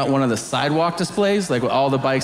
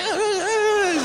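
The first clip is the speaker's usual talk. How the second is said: put-on frightened kid whimper; slower than usual. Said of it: "the kid's like".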